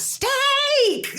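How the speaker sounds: put-on high-pitched kid's yell, drawn out; yelling child. Host imitating a child demanding dinner from dad, yelling. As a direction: holler it like a demanding kid. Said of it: "one of their children yells".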